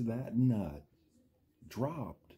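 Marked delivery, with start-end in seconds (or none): none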